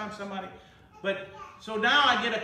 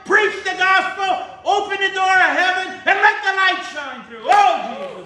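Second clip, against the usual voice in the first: excited preaching; 10 semitones higher, 12 dB louder than usual. Real, not acted. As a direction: preach it to the rafters. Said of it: "I get excited".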